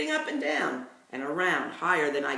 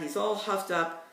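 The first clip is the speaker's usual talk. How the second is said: plain reading voice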